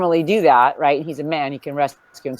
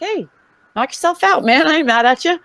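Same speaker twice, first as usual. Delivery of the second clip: breezy high voice; 12 semitones higher, 3 dB louder than usual. Retold or imitated. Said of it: "I'm like".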